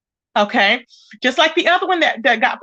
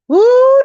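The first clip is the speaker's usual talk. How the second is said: high loud ooh; 9 semitones higher, 7 dB louder than usual. Real, not acted. Loud drawn-out exclamation in chat.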